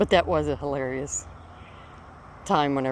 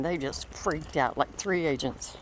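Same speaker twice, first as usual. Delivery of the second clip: ordinary talk, nothing marked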